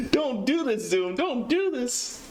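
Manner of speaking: high, hammed-up pleading, slowing at the end; mock freak-out. Streamer pleading with Zoom, mock freak-out. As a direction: beg in squeaky mock despair.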